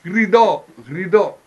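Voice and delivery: loud, high, insistent cry; exasperated teacher's shout; shouted correction. Retold or imitated. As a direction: hammer it out at full voice.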